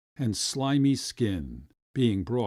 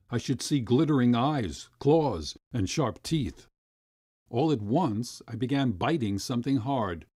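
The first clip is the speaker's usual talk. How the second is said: usual speaking voice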